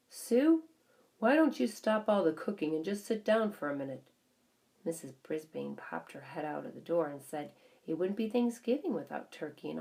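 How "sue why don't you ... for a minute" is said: higher, louder call to the kitchen. A narrator's reading. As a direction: call her from the next room. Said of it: "he called into the kitchen".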